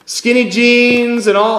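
playful overblown emphasis; mock enthusiasm. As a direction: ham up the trendy look. Mocking a trendy preacher.

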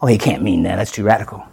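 low, soft mock objection; doubting objector. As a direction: drop low and voice a dismissive doubter. Voices a doubting listener.

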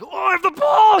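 high, loud burst of glee at getting the ball; excited yell. Retold or imitated. Pastor shouting his younger self getting the ball, hammed-up. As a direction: yelp it like an overeager kid. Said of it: "I would just be like".

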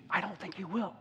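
very soft, unsure plea; hushed and hesitant, barely voiced; doubtful whispered prayer. Shown as a demonstration. Preacher showing the prayer before the change, hushed doubt. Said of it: "It was dramatic, this change".

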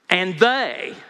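"they" punched and drawn out with disdain; stress on THEY. Shown as a demonstration. [name] disdaining these guys, heavy stress on "they". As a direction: land hard on "they", disdainfully. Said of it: "is disdaining these guys".

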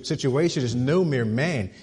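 usual speaking voice

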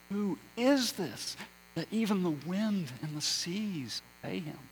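high, hushed-ending astonished question; awed terror. Acted. Disciples to one another after the storm obeys, awed terror. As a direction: ask it in mild, awestruck fear. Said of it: "looked at one another on that occasion in terror".

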